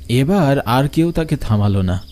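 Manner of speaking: usual speaking voice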